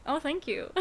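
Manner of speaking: mock being delighted by an insult; oblivious Trump. Oblivious politician answering a heckler, mock grateful. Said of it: "he would be like".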